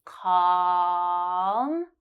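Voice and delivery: "Calm" with the ah held for three seconds. Shown as a demonstration. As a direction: stretch the ah of "Calm" long. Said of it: "hold ah for three seconds".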